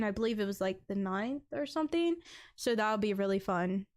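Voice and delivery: usual speaking voice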